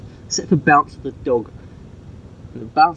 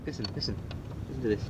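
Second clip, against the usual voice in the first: much softer, hushed so the bark can be heard; hush, straining to hear the dog; 17 dB quieter, much faster than usual. Real, not acted. Owner hushing to record the dog, much softer.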